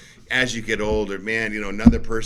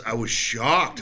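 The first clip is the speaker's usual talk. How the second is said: ordinary talk, nothing marked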